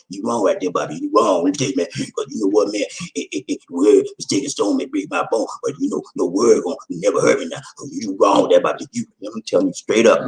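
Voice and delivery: very low, muttered mock protest; mock objector. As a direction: grumble a put-on objection way down low. Acting out someone disputing with Bobby.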